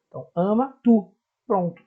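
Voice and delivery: normal talking voice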